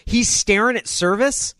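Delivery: high, heated mocking rant; mocking tirade. Real, not acted. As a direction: sneer at the player. Mocking rant about player.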